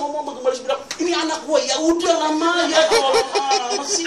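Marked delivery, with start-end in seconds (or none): 1.0-1.6 high, loud imitation of a bragging mom; proud mother boasting. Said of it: "bangga dia". 1.6-4.1 high, loud, drawn-out comic begging; exasperated plea. Retold or imitated. Embarrassed son begs boasting mother.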